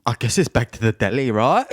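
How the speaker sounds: imitated British contestant, meekly resigned; lightly put-on British accent; British contestant accepting defeat. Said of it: "That's very British".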